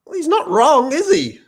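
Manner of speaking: high and loud sarcastic agreement; sarcastic high squeal of agreement; mock agreement. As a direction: play it as over-the-top ironic approval. Ironic comment on read-out post.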